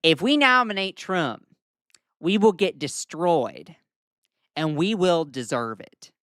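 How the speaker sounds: slow Southern senator drawl; slow Southern accent impression; Lindsey Graham voice. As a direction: stretch it out slowly in a Southern accent like an old Southern senator. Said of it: "let me do it in Lindsey Graham's voice".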